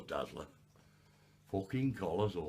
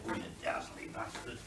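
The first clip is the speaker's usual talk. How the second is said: normal talking voice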